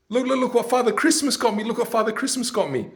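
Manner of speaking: put-on high, eager little kid voice; a child showing off his present; excited kid. Excited child telling dad about his present.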